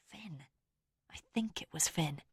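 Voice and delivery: hushed, reluctant whisper; fearful whisper. A narrator's reading. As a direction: breathe the name, afraid.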